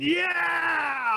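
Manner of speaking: sudden high shout of joy at the crossbar; excited shout. Retold or imitated. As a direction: leap up and yell yeah as the ball hits the bar. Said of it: "I went".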